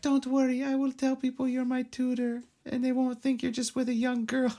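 very high, sugary imitation of a girl; sweet girl voice. Co-host imitates the girl reassuring her tutor, sweet voice. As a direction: put on a sweet little high voice. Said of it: "a sweet voice".

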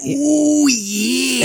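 very high, drawn-out wrestler "ooh yeah"; a hammed-up Macho Man growl of approval; Randy Savage. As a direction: pro wrestler catchphrase voice. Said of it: "That was Randy Savage".

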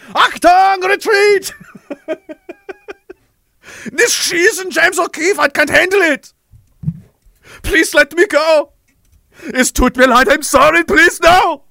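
put-on high, loud pleading in a German accent; panicked German soldier. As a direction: beg for mercy in a fake German accent. German soldiers beg and flee.

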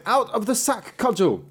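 a sharp high call to the cudgel; commanding cry. A narrator's reading. Apprentice commands the magic cudgel.

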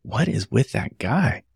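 soft, slow, hushed aside; retold whisper. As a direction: lightly imitate a hushed, disbelieving question. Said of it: "she whispered".

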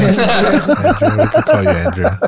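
high laughing Andrea; teasing laugh. Real, not acted. Teasing a co-host about a female name, laughing. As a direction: tease the new name with a laugh.

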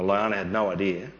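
ordinary talk, nothing marked

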